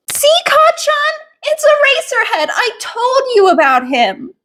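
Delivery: put-on a kid bursting with pride at meeting his hero; excited fanboy. Excited fan boy to bully.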